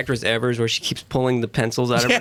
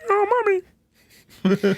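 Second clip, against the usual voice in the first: put-on very high pinched kid whine; tiny boy voice; slower than usual. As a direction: whimper it like a little boy. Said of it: "Does nobody remember that voice?".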